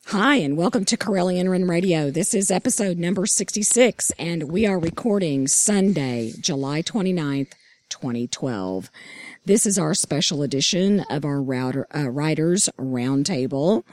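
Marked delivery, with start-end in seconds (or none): none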